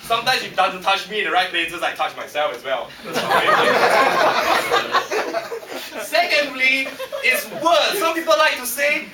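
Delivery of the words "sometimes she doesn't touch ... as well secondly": ordinary talk, nothing marked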